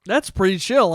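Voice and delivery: normal talking voice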